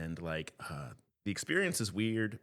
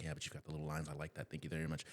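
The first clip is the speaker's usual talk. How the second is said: quiet; 3 semitones lower, 10 dB quieter, faster than usual. Retold or imitated. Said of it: "He's like".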